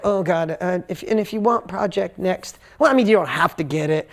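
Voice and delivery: hammed-up sheepish hedging with ums; timid sales pitch. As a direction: play a reluctant seller backing off.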